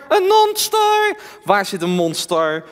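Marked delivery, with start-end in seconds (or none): 0.1-1.2 high, slow puppet voice; frightened doll asking; talking doll. Acted. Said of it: "Henk is mijn pratende pop".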